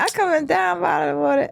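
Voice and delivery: squeaky voice. Retold or imitated. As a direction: rap it like a squeaky little kid. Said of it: "do that again".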